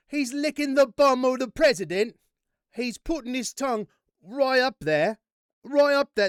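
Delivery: loud, high-pitched Cockney yell; exaggerated Cockney accent, shouted high; Cockney impression. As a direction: belt it out as an over-the-top Cockney. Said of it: "That's my Cockney impression accent".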